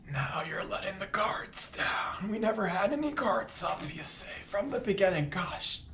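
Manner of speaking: high, hammed-up teary whisper; mock emotional. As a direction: play at being choked up and vulnerable. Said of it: "Now you got me emotional".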